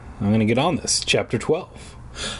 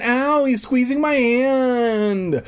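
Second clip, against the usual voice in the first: put-on high, drawn-out childish whine; crying out; 10 semitones higher, much slower than usual. Little girl with her hand squeezed, crying out. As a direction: wail slowly like a hurt child. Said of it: "Alicia cried".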